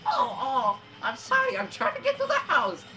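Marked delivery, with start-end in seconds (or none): 0.1-0.8 high, loud cries of hurt; pained yelping. Pretend. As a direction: yelp as each hit lands. Character gets hit in game.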